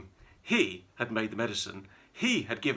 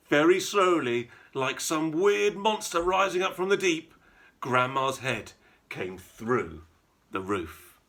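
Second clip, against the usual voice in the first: ordinary delivery, nothing marked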